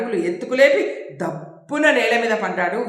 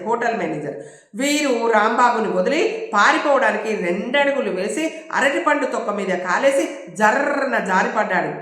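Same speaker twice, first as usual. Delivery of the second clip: plain reading voice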